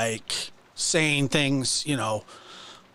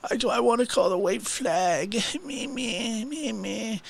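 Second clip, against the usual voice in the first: high, mocking whine of a man backing down; mock whiny backdown; 8 semitones higher than usual. Mocking him as he calms down after a rant, in a high whine. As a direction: whine it high like a sulky child giving up. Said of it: "and he's like".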